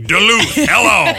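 high-pitched talk-show host taking a call; Larry King. Acted. Host greeting a caller on air, hammed-up. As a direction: punch the city, then a hello. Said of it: "It's like Larry King".

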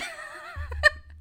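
very high, loud laugh; shrieking laugh. Real, not acted. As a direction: crack up loudly, high-pitched. Big laugh after fort.

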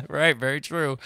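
laughing. Real, not acted. Laughing agreement after a joke.

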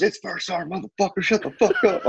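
high-pitched mock chewing-out; imitated angry sergeant. First sergeant on the phone to handcuffed airman, mock furious. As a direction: imitate a sergeant tearing into someone.

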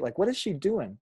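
normal talking voice

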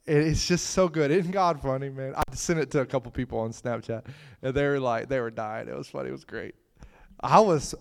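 normal talking voice

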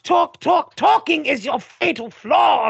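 high shouted taunt; mock taunting shout. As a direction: throw it at the villain defiantly. Voicing heroine's taunt.

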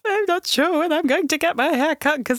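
high, wavering put-on wail; hammed-up wobbly complaint; mock lament. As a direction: play up the haircut envy. Voices own envy over friend's haircut.

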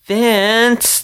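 drawn-out high whine, loud; whined. Acted. Emma whining at Vince to stop teasing, high and loud. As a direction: whine his name like a frustrated kid. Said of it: "the mouse whined".

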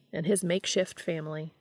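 ordinary delivery, nothing marked

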